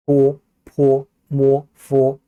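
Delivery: 뽀, 포, 모, 포 each held long on a level tone; lip sounds. Shown as a demonstration. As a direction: recite 뽀, 포, 모, 포 slowly from the lips. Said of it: "입술 소리예요".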